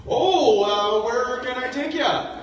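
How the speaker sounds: high, loud, slow mock-Indian; wife's awful Indian accent. Husband imitates his wife's attempt at Indian, terrible accent.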